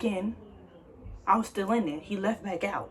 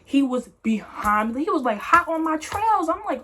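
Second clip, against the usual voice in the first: ordinary delivery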